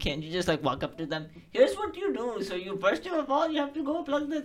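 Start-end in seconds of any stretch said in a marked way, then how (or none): 1.5-4.4 high know-it-all explainer voice. Pretend. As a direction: play the eager tech kid. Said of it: "aren't you the I T kid".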